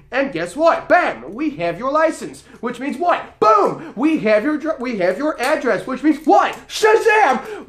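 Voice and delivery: high-pitched build to a shouted punch word; triumphant reveal. Retold or imitated. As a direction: showman springing a trap step by step. Gleefully retells trapping him, step by step.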